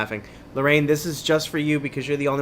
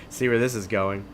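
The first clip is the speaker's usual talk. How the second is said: usual speaking voice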